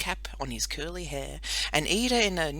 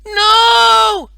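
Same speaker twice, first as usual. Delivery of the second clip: much higher, much louder shouted no; shouting back; 19 dB louder than usual. Acted. Girl shouting down to her brother, much louder. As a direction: yell it down from the top of the pole. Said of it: "she shouted back".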